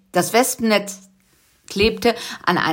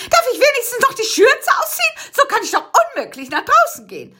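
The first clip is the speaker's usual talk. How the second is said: nagging aunt; faster than usual. Acted. The aunt fusses to Busy about her apron, high and shrill. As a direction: nag like a fussy old lady. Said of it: "zeterte Tante Cassandra".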